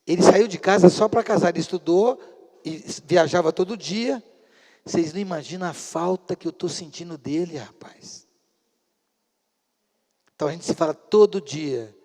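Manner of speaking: ordinary talk, nothing marked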